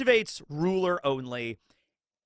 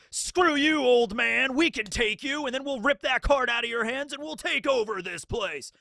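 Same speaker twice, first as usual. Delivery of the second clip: raised, higher-pitched hammed-up challenge; mock defiance; 6 semitones higher than usual. Imagined gang defying the old game master, mock-defiant. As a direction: throw out a mock defiant threat.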